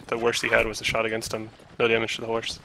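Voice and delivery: ordinary delivery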